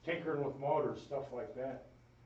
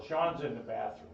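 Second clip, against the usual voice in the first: normal talking voice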